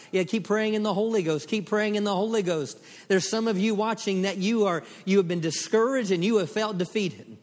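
loud, high, fervent preaching; fiery preaching. Acted. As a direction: preach it with full fire. Preaching at full force.

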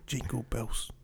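hushed, menacing Christmas whisper; spooky whisper. Acted. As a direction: whisper it like a lurking villain. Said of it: "Why do you sound so scary?".

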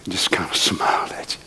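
low, soft whisper. Real, not acted. Preacher telling Brother Melvin how Christ smiled, soft whisper. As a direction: let it fall to a whisper.

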